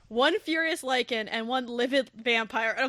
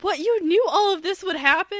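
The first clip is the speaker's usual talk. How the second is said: higher, louder, incredulous; astonished heroine; 6 semitones higher, 8 dB louder than usual. Retold or imitated. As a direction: gasp out the realization. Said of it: "Ree's like".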